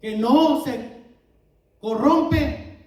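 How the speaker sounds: predicando fuerte, con convicción; proclámalo con fuerza ante la congregación; predicación enérgica. Acted. (sermón enérgico y fervoroso)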